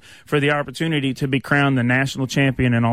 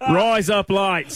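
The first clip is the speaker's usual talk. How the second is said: slow, higher-pitched Aussie impression; exaggerated Australian accent, drawn out; mock Australian accent; 5 semitones higher, much slower than usual. Shown as a demonstration. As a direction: stretch it out like an Australian saying "razor blades". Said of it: "sounds like an Australian saying razor blades".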